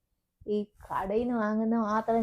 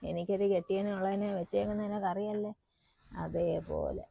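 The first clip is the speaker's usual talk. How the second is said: confiding undertone; 3 semitones lower, 6 dB quieter than usual. Acted. Mother gives secret advice softly.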